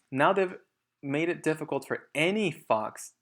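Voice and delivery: plain reading voice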